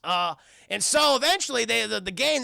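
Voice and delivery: normal talking voice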